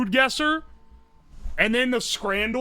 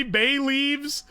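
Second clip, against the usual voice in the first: ordinary talk, nothing marked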